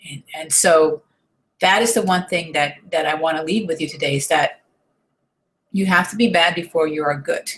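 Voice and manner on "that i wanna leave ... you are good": ordinary talk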